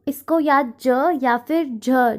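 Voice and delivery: ज and झ said slowly; slow letter demo. Shown as a demonstration. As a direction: spell out ज or झ. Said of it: "इस तरीके से भी हम प्रोनाउंस करते हैं". (ज और झ को धीरे-धीरे बोलें)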